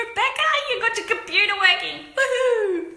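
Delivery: high excited greeting; thrilled woohoo; excited cheer. Real, not acted. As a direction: celebrate a friend's win. Greeting viewer who fixed computer.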